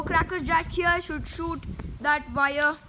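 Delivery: ordinary talk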